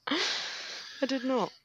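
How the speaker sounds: slow, high, drawn-out I did not; mock denial. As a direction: stretch out a playful protest.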